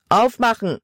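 a man barking an order through the door; hammering intruder shouting; shouted demand. A narrator's reading. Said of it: "rief ein Mann".

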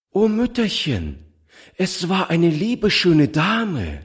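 put-on high, bright little girl's voice, slowed; joyful child. A narrator's reading. Little girl tells her foster mother, high and joyful. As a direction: cry it out gleefully, hopping about. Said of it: "rief das Kind, fröhlich durchs Zimmer hüpfend".

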